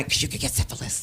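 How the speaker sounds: soft unvoiced whisper; whispered mother's warning; hushed warning. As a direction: whisper the danger.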